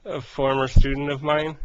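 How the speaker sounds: normal talking voice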